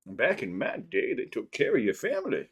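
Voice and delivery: a hint of a joking elder; mock old-timer. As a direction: playfully imitate an old-timer, softly. Mock old-timer line, then "seriously.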